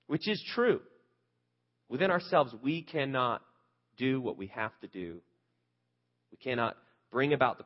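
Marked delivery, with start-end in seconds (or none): none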